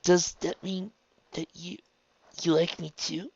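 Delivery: high, halting, nervous question; timid hope. A narrator's reading. Raindrops asks if Trixie likes her, timidly. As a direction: edge up to the question, faltering midway.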